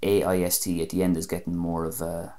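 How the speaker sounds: normal talking voice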